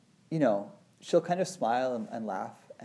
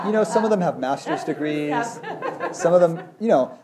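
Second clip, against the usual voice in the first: laughing; 10 semitones higher, 6 dB louder than usual. Real, not acted. Laughing at his own joke.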